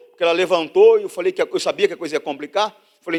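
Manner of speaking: loud, high, driving sermon voice; fervent pulpit preaching; preaching at full force. Acted. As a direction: preach it out to the whole church.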